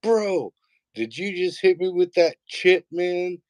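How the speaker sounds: high-pitched gamer kid mock yell. Nine-year-old yelling over online gaming, high mock yell. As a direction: imitate an excited boy yelling mid-game. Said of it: "he's, like, yelling".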